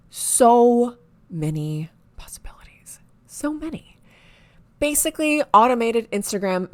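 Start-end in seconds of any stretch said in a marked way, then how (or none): 0.1-4.0 slow, drawn-out whisper of wonder; awed whisper. Pretend. Marveling to viewers at the possibilities, awed whisper. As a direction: whisper it as if dazzled by the options.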